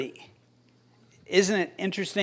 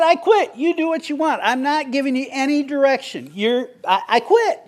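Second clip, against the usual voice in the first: defeated outburst; 7 semitones higher than usual. Retold or imitated. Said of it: "I said".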